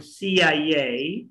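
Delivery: slow C I A as "see-yai-yay"; drawn-out C I A for copying; say after me. Shown as a demonstration. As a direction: stretch and link C I A. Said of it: "try to say after me".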